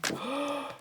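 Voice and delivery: a sharp breathless gasp of wonder; awed gasp. Real, not acted. Arriving at the Diana exhibit, a sharp awed gasp. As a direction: gasp at the sight of it.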